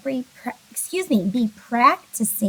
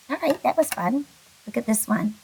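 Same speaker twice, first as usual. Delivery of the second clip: ordinary talk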